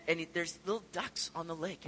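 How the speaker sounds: ordinary delivery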